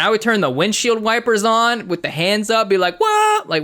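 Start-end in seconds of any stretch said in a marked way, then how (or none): 3.0-3.5 high, drawn-out whoop; mock driver's cry, pitched way up; excited whoop. Train driver setting off with hands up, high excited whoop.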